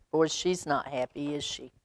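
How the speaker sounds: ordinary delivery, nothing marked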